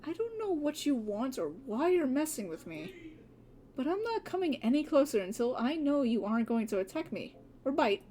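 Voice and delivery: ordinary talk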